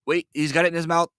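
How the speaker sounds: high, urgent re-enacted calls; retold excited yelling. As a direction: act out frantically following a fish bite. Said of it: "as I'm yelling".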